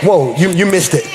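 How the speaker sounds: usual speaking voice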